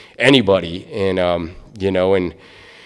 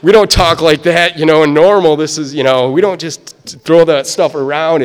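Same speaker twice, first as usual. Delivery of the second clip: much higher and louder, hurried hushing; alarmed protest; 6 semitones higher, 8 dB louder than usual. Retold or imitated. Telling his sponsor off in front of the DOT lady, high and loud. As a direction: loudly hush the sponsor, a little panicked. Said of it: "I'm like".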